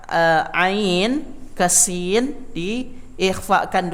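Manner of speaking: drawn-out slow teaching voice; slowed explanation. Shown as a demonstration. Teacher slows down to show the ikhfa. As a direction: stretch ain ke sin very slowly. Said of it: "disamarkan dulu".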